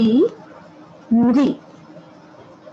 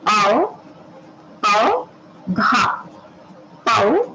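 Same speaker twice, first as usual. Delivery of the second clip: normal talking voice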